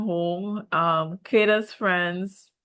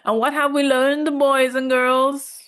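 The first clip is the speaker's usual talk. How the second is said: mock teacher; 6 semitones higher, 6 dB louder than usual. Vlogger plays teacher asking viewers what they learned. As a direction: ask it like a teacher summing up a lesson. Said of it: "Production will manipulate the situation".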